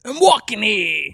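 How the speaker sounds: slow, loud mock Boston accent. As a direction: bellow it like a Boston cabbie. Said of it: "I don't know how to say that in, in a Boston accent".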